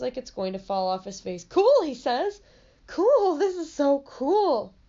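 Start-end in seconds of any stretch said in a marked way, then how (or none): 1.4-4.7 excited. Acted. Birthday boy gets gift.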